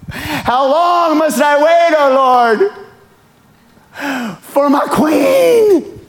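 overacted lovesick lament to God; a single begging for a spouse, crying melodramatically; hammed-up wailing prayer.

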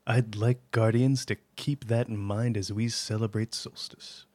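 low, soft, measured commander's address; Zavala. Acted. Commander addressing Guardians at Solstice, low and measured. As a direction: deliver it as a solemn commander, quiet and deliberate. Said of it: "Vanguard Commander Zavala".

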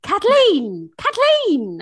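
high, loud Irish screaming of a name; Irish accent. Retold or imitated. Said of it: "That's what you're saying".

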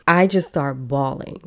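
ordinary delivery

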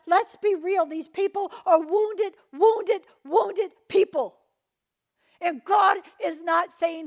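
loud, slow, high preaching cry; preaching shout. Real, not acted. Preacher crying out to the church about wounded leaders, loud. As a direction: drive home wounded, wounded, slowly and loudly.

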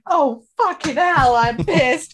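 loud, high, drawn-out tipsy outburst; imitating drunk mum. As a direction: announce it loudly as a wrecked mum would. Imitates her wrecked mum.

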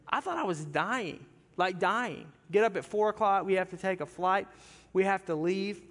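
usual speaking voice

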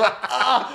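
short high yelp of pain; mock pain cry. Retelling being kicked in the hip, high yelp.

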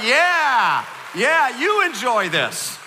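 slow, loud, teasing; mock gloating. Pastor gloating at the other campuses, loud and slow. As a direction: savor the payback. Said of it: "we're like".

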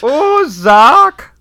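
Oh, Jacques drawn out slowly, high and loud; slow German accent. Pretend. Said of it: "silly over-the-top German accent".

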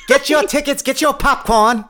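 high laughing vaudeville barker; old-timey announcer. Pretend. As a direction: hawk it like an old theater vendor. Joining the old-timey theater bit.

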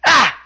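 short, high, loud yelp; one sudden punched "Ah!" in imitation; Tozawa's "Ah!" cry. Wrestler answers into the mic, short high imitated yelp. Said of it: "Tozawa simply says into the mic".

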